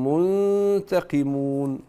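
منتقمون said slowly with a lengthened nasal ghunna; teacher stretches the ghunna in منتقمون; slow model of منتقمون, nasal held long. Shown as a demonstration. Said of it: "الغنة قصيرة، زوديها شوية".

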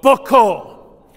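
loud Boko, falling; Twi reply. Shown as a demonstration. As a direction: answer in Twi firmly. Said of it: "Let me hear you say that".

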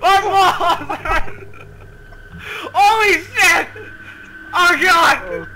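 high-pitched startled outcry; startled yelp. Real, not acted. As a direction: yelp as if something just jumped out. Jump scare in horror game.

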